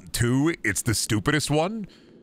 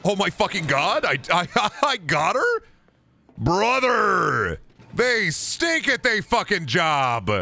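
high outraged yelling; frustrated shouting; 9 semitones higher, slower than usual. Real, not acted. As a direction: yell at the useless guards. Caught by enemy, yelling.

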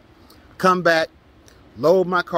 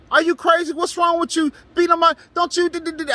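going off; 13 semitones higher than usual. Retold or imitated. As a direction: explode at her, sputtering and stuttering at the end. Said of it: "went ham".

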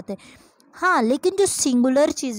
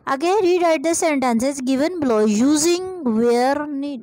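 slow dictation; much slower than usual. Shown as a demonstration. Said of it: "reading exercise instruction".

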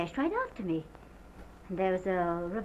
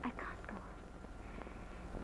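barely whispered, very slow; too overcome to voice the words; 20 dB quieter, much slower than usual. Acted. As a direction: give up in a faint, drawn-out whisper. Witness breaks down mid-testimony.

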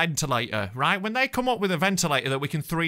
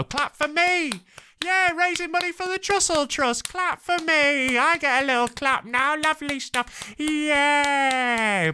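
mock begging for applause; 9 semitones higher, slower than usual. Comedian jokingly begging listeners to clap, high hammed-up cheer. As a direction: ham up a needy cheer.

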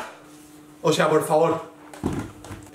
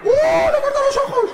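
high squeal. Real, not acted. Squeals at gory game moment.

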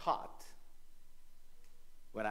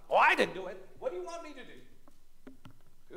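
high, put-upon whine; snotty sarcasm; 13 semitones higher than usual. Acted. Defense lawyer brushing off the charge, snotty. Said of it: "he is kind of very snotty".

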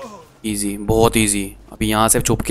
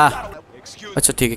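whisper; 10 dB quieter, much slower than usual. Real, not acted. Sneaking past in game.

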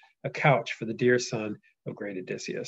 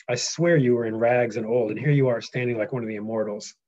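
louder epic read; steady storyteller reading; reading aloud; 6 dB louder than usual. Acted. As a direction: marvel at the change. Reads Telemachus aloud.

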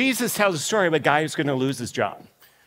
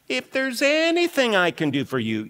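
acted gratitude, "anything" raised and stretched; emphatic grateful offer; 6 semitones higher than usual. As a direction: lean on "anything", pitched high, as a heartfelt pledge. Debtor repeats offer with emphasis.

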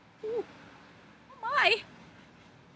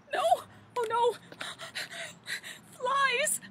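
higher, hammed-up fright at the flies; mock panic; 9 semitones higher than usual. Mother Goose spots flies, hammed-up higher panic. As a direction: squeal in pretend alarm.

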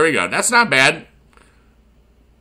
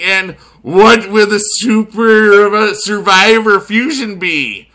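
mad scientist; 8 semitones higher, 8 dB louder, slower than usual. Pretend. Teasing the next reveal.

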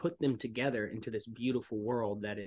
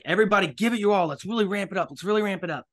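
fast, much higher and louder pep shout; hyped director; 8 semitones higher, 10 dB louder, faster than usual. Retold or imitated. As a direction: fire up the whole crew. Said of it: "he's like".

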